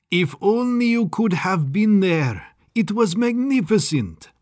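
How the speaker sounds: put-on high, lilting German-accented scientist; gleeful mad doctor. A narrator's reading. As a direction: gush with eccentric delight.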